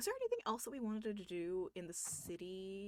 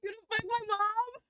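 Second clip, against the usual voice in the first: mock outrage; 12 semitones higher, 8 dB louder than usual. Real, not acted.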